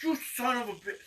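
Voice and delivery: high-pitched, acted cry of outrage; angry outburst. Man cursing as his rifle flies away, angry outburst.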